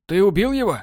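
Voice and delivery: high sharp alarmed question. A narrator's reading. Borland seeing the beaten elf, high alarmed question. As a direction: snap it out in alarm.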